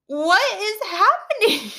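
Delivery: high, slow, stretched-out bewilderment; baffled disbelief. Retold or imitated. Teacher watching students play murder, high baffled disbelief. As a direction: draw it out high, totally lost.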